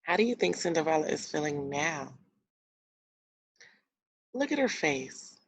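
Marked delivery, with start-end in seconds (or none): none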